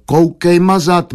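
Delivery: mildly thundering dismissal; thundering retort. A narrator's reading. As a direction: roar it back, drawn out. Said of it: "zaburácel".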